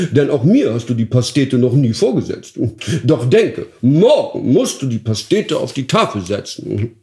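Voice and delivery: lightly voiced duke laying down the law; commanding duke. Duke commanding his dwarf cook, haughty.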